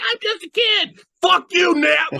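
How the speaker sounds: very high shrieked outburst; mock screaming. Movie fan acting out a fight with Neve, mock screaming.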